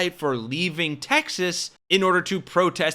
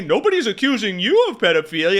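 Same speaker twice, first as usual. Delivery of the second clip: quick, louder mocking quote of the host; 2 semitones higher, 5 dB louder, faster than usual. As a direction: sneer it back at him like a dumb host. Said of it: "The dumbass goes on to say".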